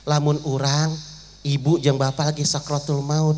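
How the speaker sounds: usual speaking voice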